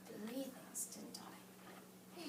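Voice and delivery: a hushed whisper. Real, not acted. Teacher whispers to class, checking understanding. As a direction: whisper the tag question.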